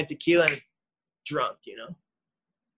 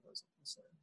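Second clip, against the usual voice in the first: hushed, mocking repeat of mom's phrase; whispered mock; 27 dB quieter, faster than usual. Son mocks his mother's boundary in a hushed aside. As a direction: breathe it out as a sneering aside.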